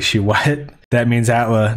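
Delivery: ordinary talk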